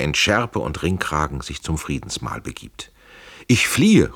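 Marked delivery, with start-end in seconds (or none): 3.5-4.1 called out high and loud; raised voice, crying out a farewell; urgent call. A narrator's reading. Trenck tells his friend he is fleeing, urgent call. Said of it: "ruft Tränk".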